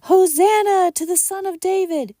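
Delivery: put-on high, loud children's acclamation; children crying out. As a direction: cry it out like excited children. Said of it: "children crying out".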